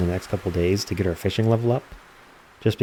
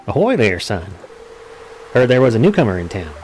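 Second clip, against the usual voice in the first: put-on old sailor voice; 3 semitones higher than usual. Gamer voicing old fisherman Willy, hearty sailor voice. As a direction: play the salty old dock sailor hailing a newcomer. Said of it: "he gets to remember British at the end there".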